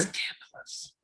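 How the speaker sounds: hushed, teasing whisper; playful scandalized aside, fully whispered; mock-scandalized whisper. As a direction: whisper it like juicy gossip. Teasing aside at friend's hookup.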